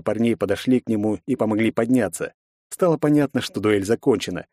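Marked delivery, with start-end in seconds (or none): none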